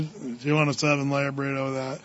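a flat-out unenthusiastic cashier; bored fast-food kid. Retold or imitated. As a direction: mumble the upsell without interest. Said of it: "it got down to".

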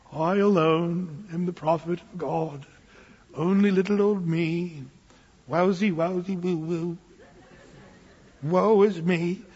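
mock self-pitying whine, laughing at the end; whiny self-pity. Pastor mocks Elijah feeling sorry for himself, in a whiny voice. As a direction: ham up a sulky lone prophet. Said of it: "I call this the, the Elijah complex".

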